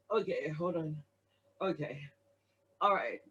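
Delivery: calm yourself down quietly; murmured self-talk after a laughing fit; collecting herself. Real, not acted.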